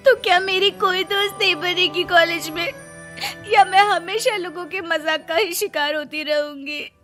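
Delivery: tearful wail. Acted. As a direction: sob out the self-pity. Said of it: "Raina जोर-जोर से रोने लगती है". (रोते हुए शिकायत)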